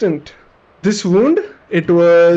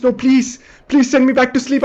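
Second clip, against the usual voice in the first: higher, desperate begging; pleading; 6 semitones higher than usual. Retold or imitated. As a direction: beg them to put you under. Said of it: "And then I'm like".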